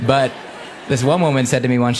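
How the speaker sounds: ordinary talk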